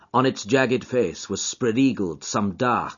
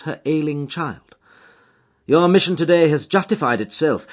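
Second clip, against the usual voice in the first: higher, louder, emphatic detective voice; raised, insistent voice, pressing the warning home; Holmes, commanding detective; 7 semitones higher, 5 dB louder than usual. A narrator's reading. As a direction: lay out the case with urgent authority. Holmes setting out his case.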